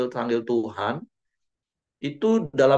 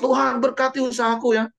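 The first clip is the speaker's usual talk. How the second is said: high, pushy demand; mock a stubborn believer who won't let go; hammed-up insistent plea; stubborn insisting; 11 semitones higher, faster than usual. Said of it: "kita ngotot".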